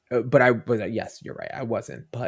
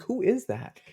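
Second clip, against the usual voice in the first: put-on much higher curious child; little girl voice; 12 semitones higher than usual. Daughter asking about the character, much higher. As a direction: ask like a small girl.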